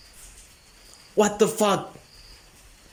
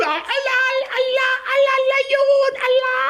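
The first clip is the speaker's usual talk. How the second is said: excited crowd cry. Retold or imitated. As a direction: call out like thrilled onlookers. Crowd cheering at the lion.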